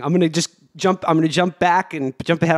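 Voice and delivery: usual speaking voice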